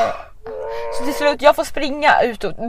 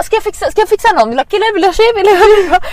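eager offer; 6 semitones higher, faster than usual. Retold or imitated. Marcus offering to hook up.

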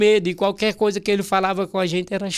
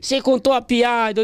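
raised, mock-angry accusation; Ratinho, angry; 5 semitones higher than usual. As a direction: imitate the irate boss scolding. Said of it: "brabo, sabe aquele jeitão dele?". (bronca imitada, voz mais aguda)

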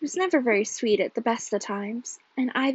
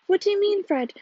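high-pitched puzzled question; ask, quite taken aback; bewildered; 8 semitones higher than usual. A narrator's reading. Wife questioning her excited husband, bewildered. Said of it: "said Ivy in bewilderment".

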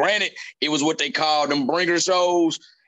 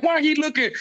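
high, baffled question; light mock confusion; mock puzzled; 10 semitones higher than usual. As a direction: pitch it up, confused. Retold confusion again.

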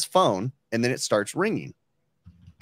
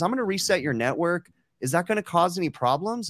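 lightly voiced old lady, pitched up; hint of a helpful support lady, higher; Marianne; 6 semitones higher than usual. Retelling support lady warning caller, lightly voiced old lady. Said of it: "imagine you're little old Marianne".

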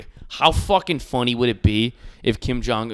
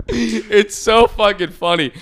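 ordinary talk, nothing marked

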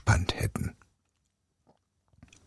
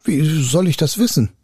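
higher-pitched unworried retort; fearless; 5 semitones higher, 3 dB louder than usual. A narrator's reading. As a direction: answer back without fear. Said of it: "antwortete der Schüler ohne Furcht".

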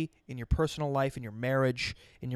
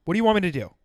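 louder, higher, exasperated; exasperated outburst; 4 semitones higher, 6 dB louder, much faster than usual. Real, not acted. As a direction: throw up your hands and say it. Muttered then repeated loud.

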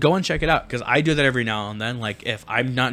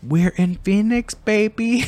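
teasing sing-song; 8 semitones higher, slower than usual. Real, not acted. Repeats reveal, teasing.

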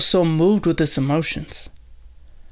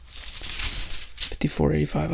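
slow, very soft half-whisper; barely voiced, drawn-out self-talk; murmuring to self; 8 dB quieter, slower than usual. Real, not acted. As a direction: mumble the number slowly while searching. Searching for the reference number.

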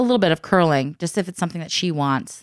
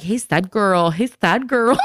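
mock sing-song tease; 4 semitones higher, 5 dB louder, slower than usual. Husband mock-greeting daughter.

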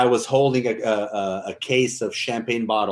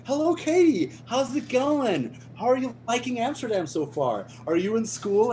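Ryan Reynolds charm; 11 semitones higher than usual. Retold or imitated. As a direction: play the perfect charming guest. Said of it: "He was like".

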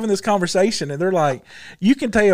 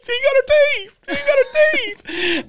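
very high wailing mama imitation; shrieky mock pleading; mock hollering. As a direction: holler a hammed-up plea, high and strained. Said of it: "now she's hollering".

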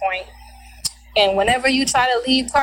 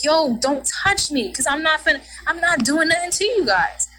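retold upset protest; 6 semitones higher than usual. Pushed and smacked, she objects.

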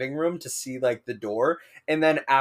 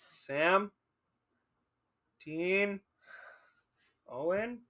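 soft, higher, questioning call; tentative calling; 5 semitones higher, 6 dB quieter, much slower than usual. Retold or imitated. Friend at the roommates' door, calling names tentatively. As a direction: call each name softly, leaving long gaps.

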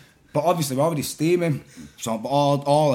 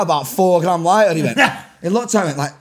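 ordinary talk

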